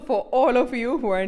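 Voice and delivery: words through laughter; laughing while talking. Real, not acted. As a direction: keep laughing as you talk. Still laughing after punchline.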